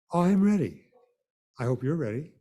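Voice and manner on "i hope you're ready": normal talking voice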